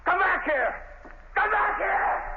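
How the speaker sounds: frantic yell, rising on the repeat; desperate shout. Acted. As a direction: scream after him as he walks away. Prisoner yelling after departing lawyer.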